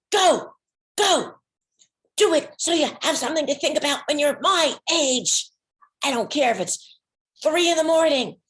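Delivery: put-on high, loud, urging old aunt; fiery old great aunt. Ninety-year-old great aunt urging her niece on the phone, loud and high. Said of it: "called me up and she said".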